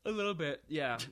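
words stretched by a yawn. Real, not acted. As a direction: talk through a yawn. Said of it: "I'm sorry, I'm yawning".

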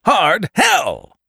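high, loud furious shout. A narrator's reading. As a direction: bark it out in rage. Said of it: "shouted the man".

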